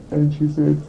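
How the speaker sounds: ordinary talk, nothing marked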